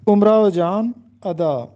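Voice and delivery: امراؤ جان ادا said umrao jaan, pause, ada; درست تلفظ. Shown as a demonstration. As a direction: say امراؤ with 'u', no link after جان. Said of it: "جو کہ غلط ہے۔". (امراؤ جان ادا: اُمراؤ جان، ادا)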